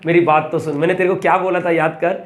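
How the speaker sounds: ordinary talk, nothing marked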